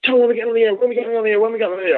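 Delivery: high, eager repeated pleading; eager pestering. Retold or imitated. Simon Carr pestering Tom to get on the air, eager high pleading. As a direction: nag him about airtime. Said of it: "pulled me aside".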